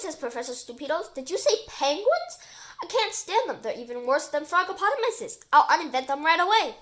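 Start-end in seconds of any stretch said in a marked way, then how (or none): 1.1-6.8 loud, high indignant rant; outraged. A narrator's reading. Professor hearing about penguins, outraged.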